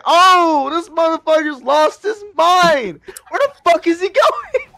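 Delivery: can't believe what he's seeing; shocked outburst. Retold or imitated. Retelling how he yelled when his friend jumped a fence, shocked and loud.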